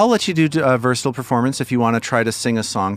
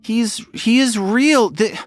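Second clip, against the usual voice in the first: put-on high, slow, louder pleading child; frightened girl; 12 semitones higher, 4 dB louder, slower than usual. Frightened girl insists to the party, high and pleading. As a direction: insist desperately that he is real. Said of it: "she looks at you and she says".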